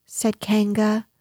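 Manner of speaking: usual speaking voice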